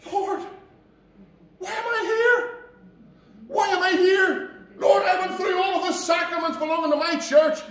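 high, pleading cries of the damned; wailing lost soul. Retold or imitated. A preacher voices the damned crying to the Lord, high and pleading. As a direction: plead up high, bewildered and desperate. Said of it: "they're going".

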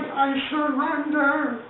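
very high, stretched-out falsetto; mock surrender cry. Charlie gives himself up, slow high mock wail. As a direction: draw out his giving up, high and hammed-up.

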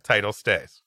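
ordinary delivery

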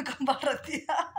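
amused. Real, not acted. Laughing at son hiding.

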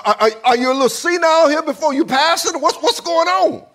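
loud, high, stammering disbelief; bewildered stammering king. Acted. King questioning Elijah about the bow, bewildered and stammering.

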